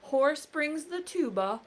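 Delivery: ordinary delivery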